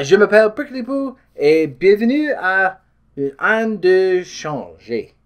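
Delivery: high, put-on French accent, slowing at the end; clumsy hammed-up French accent; horrible French accent. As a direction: ham up a bad French accent. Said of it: "it's a horrible accent".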